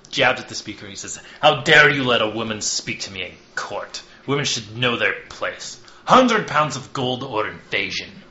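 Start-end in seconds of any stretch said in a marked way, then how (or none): 1.4-8.2 put-on loud, indignant envoy in a light Russian accent. Hawthorne furious at the court, light Russian accent. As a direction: bark the demand in a slight Russian accent. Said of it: "he suddenly went Russian".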